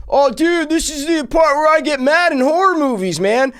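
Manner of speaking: high, loud whiny dude voice; mocking movie dude. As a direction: sneer it like the dumb movie guy. Guy in movie mocked.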